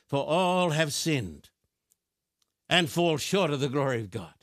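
slow, deliberate scripture reading; unhurried reading of the verse; read slowly. Shown as a demonstration. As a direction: let each word of the verse land. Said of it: "I think I should read this pretty slow".

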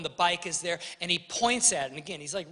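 ordinary delivery

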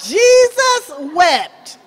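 slow, loud stress on Jesus; slow and weighty. Real, not acted. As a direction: let each word land heavily. Repeats the short verse.

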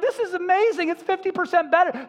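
high, loud imitation of a thrilled woman; imitated squeal of encouragement; excited. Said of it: "She was encouraged. She's like".

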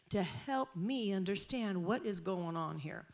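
ordinary delivery

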